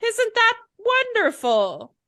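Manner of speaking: high start, dropping to normal pitch; feigned delight. Acted. Harvey with all eyes on him, feigning delight. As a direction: cover your outburst with badly faked joy. Caught out, covers his scream.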